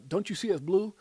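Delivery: lightly voiced donkey, loud pleading question; donkey in a joke. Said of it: "The donkey says".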